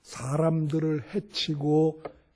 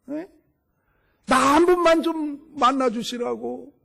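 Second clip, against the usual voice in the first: pleading, pitched higher and louder; blind man's cry; 7 semitones higher, 3 dB louder than usual. Retold or imitated. Preacher voices the blind man shouting to Jesus, pleading loudly. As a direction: cry out for mercy over the crowd. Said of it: "막 소리를 지르는 거죠".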